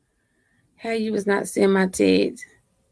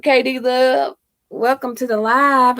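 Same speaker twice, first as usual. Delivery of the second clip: normal talking voice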